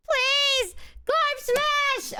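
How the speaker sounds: squeaky high monster shout; high cartoon brute voice. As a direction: yell it like a tiny cartoon hulk. Repeats the character's catchphrase.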